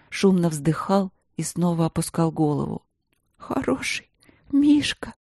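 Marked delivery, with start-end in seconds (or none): 3.4-5.1 shaky high whisper; trembling whisper. Acted. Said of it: "дрожащим голосом прошептала".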